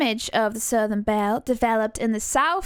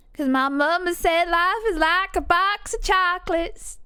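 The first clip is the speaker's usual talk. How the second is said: Southern film quote; 7 semitones higher than usual. Acted. Recalling mama's saying, high-pitched Southern accent.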